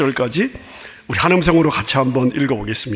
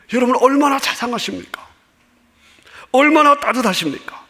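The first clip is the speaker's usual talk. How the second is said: raised high, rising wonder; fervent exclamation; 8 semitones higher than usual. Acted. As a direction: marvel aloud at God's kindness. Preacher extols God's kindness. (감격하며 높게 외치듯)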